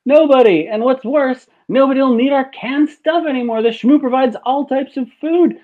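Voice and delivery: hammed-up panic over free food; alarmed outburst. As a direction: squeal it out like a shocked tycoon. Industrialist panics over the schmoo.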